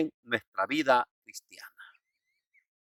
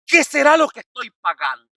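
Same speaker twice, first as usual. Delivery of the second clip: higher-pitched imitation of a troubled parishioner; feligrés angustiado; 8 semitones higher, 3 dB louder than usual. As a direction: act out a worried plea to the priest. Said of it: "con este otro problema y dice". (imitando a un feligrés preocupado, en tono agudo)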